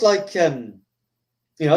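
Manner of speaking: ordinary talk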